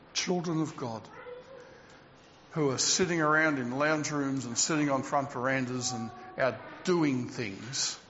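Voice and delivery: low, subdued and sorrowful; solemn lament. Real, not acted. Preacher grieving over believers who stay away, solemn lament. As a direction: let the voice sink, heavy with regret.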